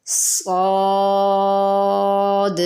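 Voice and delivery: صاد held long, heavy and hissing, no lip rounding; drawn-out heavy saad. Shown as a demonstration. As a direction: stretch صاد on a long open alif with a whistling ص. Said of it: "اس کے مخرج سے اس کو نکالنا ہے".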